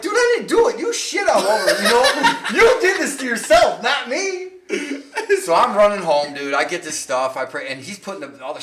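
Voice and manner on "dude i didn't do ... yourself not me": high and loud, laughing through the words; laughing, louder and much higher; laughing protest. Retold or imitated. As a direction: protest while cracking up. Retelling while laughing.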